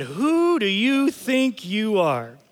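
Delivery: stretched-out replay of the lady's challenge; echoing voice. Retold or imitated. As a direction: draw it out like a remembered accusation. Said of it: "that voice echoing in my head".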